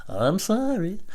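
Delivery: high, drawn-out singsong apology; exaggerated smoothing over. Man placating the angry neighbor, exaggerated sweet apology. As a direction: appease in a sugary, stretched-out high voice. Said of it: "I try to smooth it over".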